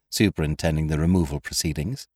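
usual speaking voice

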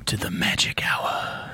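raspy breathless whisper. Pretend. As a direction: breathe the words out, no voice. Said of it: "Let's do the rest of the show like this".

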